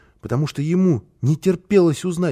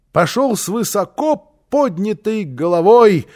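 plain reading voice

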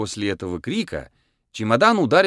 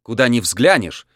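ordinary delivery

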